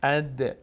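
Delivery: اد with a slight echoing release on the d; echoing qalqalah. Shown as a demonstration. Teacher showing how sukun letters vibrate, echoing qalqalah. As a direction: bounce the final d of اد.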